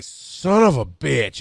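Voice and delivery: slow, drawn-out grumbled curse; retold exasperation. Narrator stuck washing the dishes, retold exasperation. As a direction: stretch the curse out in mock resentment. Acted reaction to losing the dispute.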